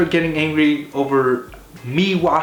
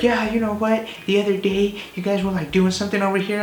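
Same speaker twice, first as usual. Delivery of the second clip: higher, nagging imitation of her; mock neighbor; 5 semitones higher than usual. Imitating the neighbor complaining about noise. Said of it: "she's like".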